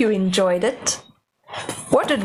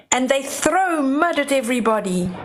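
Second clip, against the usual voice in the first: usual speaking voice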